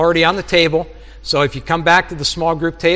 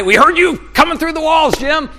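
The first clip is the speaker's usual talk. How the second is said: teasing neighbour; 10 semitones higher than usual. Pretend. Neighbours teasing through the walls.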